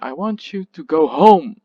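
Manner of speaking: strong, clear HOME closing the phrase; stress on HOME. Shown as a demonstration. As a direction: make home the point of contrast. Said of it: "you get that the focus is".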